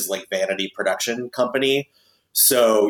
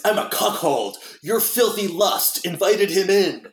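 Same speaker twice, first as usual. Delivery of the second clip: Nicolas Cage impression; 9 semitones higher than usual. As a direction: mimic Cage's odd heady line reading. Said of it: "he's doing a very particular tone of voice".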